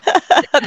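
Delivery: a short, high laugh; real laugh. Real, not acted. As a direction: laugh again, amused. Still laughing at prank.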